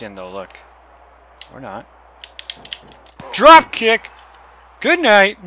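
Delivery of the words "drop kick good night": high, loud shout of the move; excited call. Real, not acted. Gamer calling his finishing drop kick, excited shout. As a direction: announce the knockout with glee.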